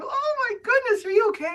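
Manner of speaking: squealed way up high; high gushing worry; fussing woman. Retold or imitated. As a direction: coo over the wounded man. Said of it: "I'm like".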